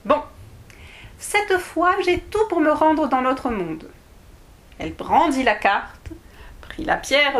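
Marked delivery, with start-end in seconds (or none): 0.0-3.9 put-on little girl voice. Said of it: "Alice dit".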